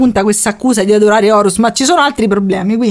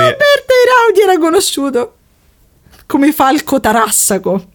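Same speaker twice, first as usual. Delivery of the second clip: trying not to laugh; 7 semitones higher than usual. Real, not acted. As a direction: voice quivering, holding back laughter. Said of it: "non ce la faccio". (trattenendo le risate)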